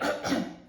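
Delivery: small mock throat-clearing; polite throat clear. Cricket interrupting the sad giraffe, polite throat clear. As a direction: clear the throat to get attention. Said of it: "coughed a cricket".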